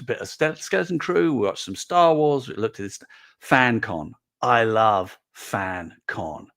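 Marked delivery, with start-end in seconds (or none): none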